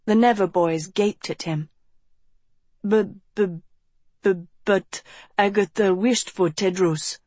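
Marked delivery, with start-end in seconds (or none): 2.9-7.2 nervous stammering; anxious stammer. Acted. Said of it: "Raven said anxiously".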